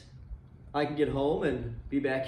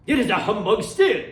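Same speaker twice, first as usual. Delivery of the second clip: louder, stubborn Scrooge voice; defiant old miser; 7 semitones higher, 8 dB louder than usual. Acted. Scrooge refusing to believe the ghost coming through the door, loud defiance. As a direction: bark the denial at the ghost.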